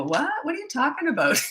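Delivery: high-pitched dopey confusion; mock bewilderment. Host mimicking herself forgetting her own question, mock bewildered. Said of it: "I get really dopey".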